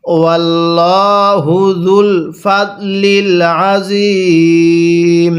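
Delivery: slow chant with lengthened vowels; slow recitation. Shown as a demonstration. Teacher reciting the verse ending. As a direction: stretch each word. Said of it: "এরকমভাবে পড়ব।".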